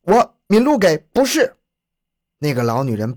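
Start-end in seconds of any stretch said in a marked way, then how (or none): 0.0-1.5 put-on high-pitched, raised old woman's retort; defiant denial. A narrator's reading. Cornered old woman denying her name to police, defiant. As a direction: deny it loudly, pitched well up.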